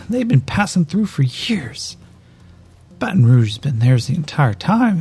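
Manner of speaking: wounded man venting at his enemy; bitter ranting outlaw. Acted. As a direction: unload years of grievance. Elias ranting about the plot.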